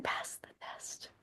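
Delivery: hushed urging whisper. Acted. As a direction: breathe it like a secret. Urging friend to pass test.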